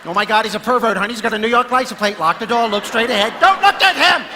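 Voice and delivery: urgent, building to a high, loud yell; mock panic. Driver warning honey about the madman alongside, mock panic. Alarmed driver in next car, acted.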